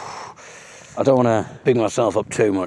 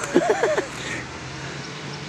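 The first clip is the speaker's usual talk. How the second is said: breathy laugh; 6 dB quieter than usual. Real, not acted. Laughs at own sound-effect joke.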